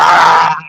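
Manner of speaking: short, sudden, loud yell; crazy animal yell. Shown as a demonstration. Coach showing how not to come across, a sudden wild yell. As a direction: let out one wild burst like a crazed coach. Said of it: "you're like".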